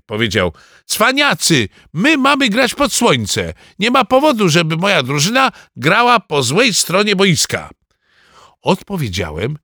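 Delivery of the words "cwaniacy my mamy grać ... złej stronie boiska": put-on high-pitched boyish indignation; disgruntled protest. A narrator's reading. Team captain objecting to playing against the sun, disgruntled. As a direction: complain in a raised, high voice. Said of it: "Gottfried był niezadowolony".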